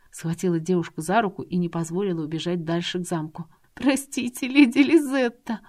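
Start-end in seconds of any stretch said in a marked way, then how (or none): 3.7-5.6 high, slow, tearful apology; a weeping girl begging forgiveness; a high voiced girl crying out her apology; tearful plea. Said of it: "Ариана заплакала сильнее".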